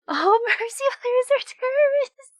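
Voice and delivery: high, loud, laughing read. Real, not acted. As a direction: laugh it out, delighted. Reading a funny chat message.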